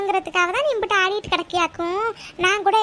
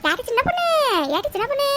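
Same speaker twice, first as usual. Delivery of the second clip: higher-pitched call for a friend; 6 semitones higher than usual. Acted. Friend calls Chinna Ponnu from outside, raised calling voice. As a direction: sing out her name to bring her out.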